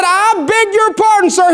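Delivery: high East Texan cowboy objecting; East Texan accent. Acted. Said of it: "in this rich East Texan accent".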